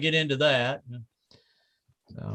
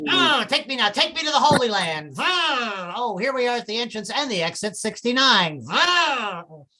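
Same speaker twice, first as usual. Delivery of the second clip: high, breathy-free mock moaning mom; mock ecstatic mother crying out to the Lord, higher; 8 semitones higher than usual. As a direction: ham up a mother in rapture, pitched up. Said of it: "my mom was going to heaven".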